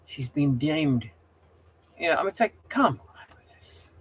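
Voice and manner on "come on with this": breathy whispered come on; exasperated whisper. Real, not acted. Streamer griping to Gale about the ban, whispering. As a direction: hiss it out in exasperation.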